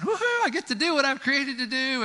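high, thrilled cheer; giddy light bulb cheering; excited light bulb. Pretend. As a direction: whoop with joy at finally being used. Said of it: "I can just see the excitement of the light bulb saying".

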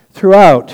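"Throughout" burst out high and loud; emphatic exclaim. Real, not acted. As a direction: hit "Throughout" like a big announcement. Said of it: "I'm not gonna spend fifteen minutes on throughout".

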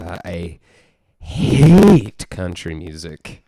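hugely drawn-out, loud HATE; almost a Trump impression, slow and loud. Real, not acted. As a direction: stretch "hate" out in a big swooping bellow. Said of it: "said that almost in a Trump voice".

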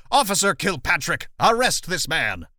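loud, high shouted command; acted shout. Political boss ordering the police chief, loud acted shout. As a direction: shout the order, drawing out the end. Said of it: "pulls the chief of police from the crowd and shouts".

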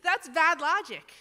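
laughing. Real, not acted. Laughs at the bad logic.

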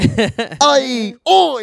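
high, badly faked British accent; mock British accent. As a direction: flounder through a cartoon Brit voice. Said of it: "I don't fucking know how to do this".

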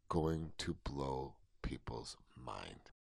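hushed, slow whisper. Real, not acted. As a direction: drop to a secretive whisper. Building suspense about new song.

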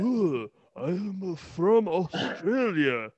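very slow, hammed-up Australian accent; mock Australian Kyrie. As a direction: drag every word out like a cartoon Aussie. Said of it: "He's like".

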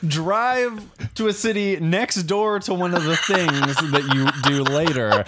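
high, fast, laughing complaint; laughing rant. Real, not acted. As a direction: rattle it off through laughter. Laughing rant about route.

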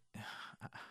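a long breathless sigh of defeat; weary sigh. Retold or imitated. Wife sighing at husband's wait question, weary. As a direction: sigh out, worn down.

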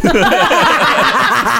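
real loud laughing fit. Real, not acted. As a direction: crack up loudly. Laughing at own story.

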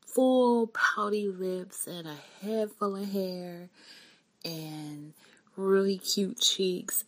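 slow, soft, adoring description; tender. Real, not acted. Describing newborn daughter's face.

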